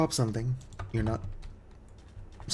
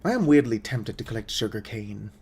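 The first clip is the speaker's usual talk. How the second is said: ordinary talk, nothing marked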